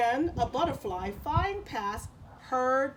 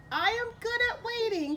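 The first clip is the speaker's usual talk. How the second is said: put-on a wise old spider, pitched up; 12 semitones higher, 4 dB louder than usual. Old spider tells the cricket she waits, high voice. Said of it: "she had told the cricket once".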